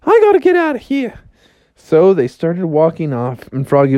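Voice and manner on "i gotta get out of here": breezy high falsetto farewell; Alice. Acted. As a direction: toss off a goodbye in a high cartoon voice. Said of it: "Alice was like".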